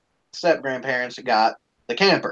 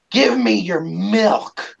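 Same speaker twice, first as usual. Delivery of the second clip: slow, higher, drawn-out demand; bratty child's demand; 5 semitones higher, much slower than usual. Retold or imitated. Daughter demands the brother's milk, slow bratty mimicry. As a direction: mimic a demanding kid, stretching the words.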